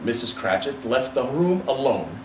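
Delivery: ordinary delivery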